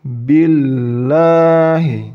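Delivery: billahi, very slow, thin held "ll"; light lam. Shown as a demonstration. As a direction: recite billahi slowly, alif skipped. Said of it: "huruf alifnya diabaikan".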